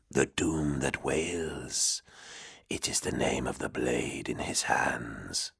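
low, slow, whispery demon voice; a cold ancient demon murmuring low. Acted. Demon explains the blade.

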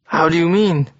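usual speaking voice

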